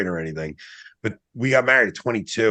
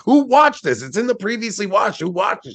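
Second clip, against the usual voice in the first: mock yell at the wife about Netflix; retold yelling; 9 semitones higher than usual. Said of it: "I was yelling at my wife".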